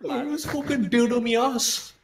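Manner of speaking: put-on high-pitched mangled British-Australian accent; abomination accent. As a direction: ham up an awful British accent. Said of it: "a fucking abomination of, like, Australian".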